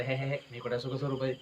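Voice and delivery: low, very soft dismissive quote; hushed, low imitation of a doubter; self-deprecating mock doubt. Voicing people who doubt their bliss.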